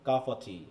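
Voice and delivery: low, soft "কাপা টি" with "of" as a bare "a"; elided "of". Shown as a demonstration. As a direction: drop the f in "কাপা টি", quietly. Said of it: "অ হয়ে গেছে". (নিচু গলায় "কাপা টি", f উচ্চারণ ছাড়া)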